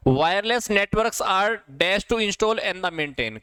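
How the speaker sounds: words read out very slowly, one by one; slow dictation. Shown as a demonstration. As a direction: read it slowly for the class to follow. Reading a quiz question aloud. (धीमी गति से सवाल पढ़ना)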